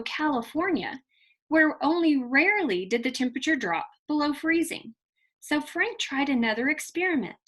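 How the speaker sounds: put-on lively read-aloud storytelling for children; expressive children's story reading; story narration. A narrator's reading. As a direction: read it like a picture book to a class. Teacher reads picture book aloud.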